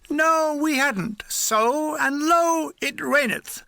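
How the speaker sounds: high, drawn-out mock scripture; mock-biblical lament. A narrator's reading. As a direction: intone it like comic scripture.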